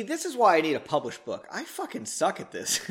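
ordinary delivery, nothing marked

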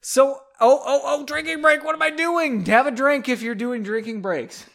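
high, loud sudden realization; excited. Real, not acted. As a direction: catch yourself, all excited. Suddenly remembers drinking break.

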